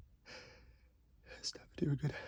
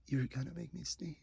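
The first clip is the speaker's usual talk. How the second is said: ordinary delivery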